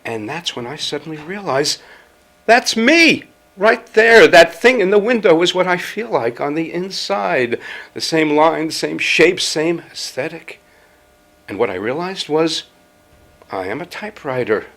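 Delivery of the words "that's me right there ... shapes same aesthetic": loud, high burst of recognition; thrilled outburst, high and loud, easing slightly; excited revelation. Acted. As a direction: cry out as the discovery hits. Sudden revelation at the shop window.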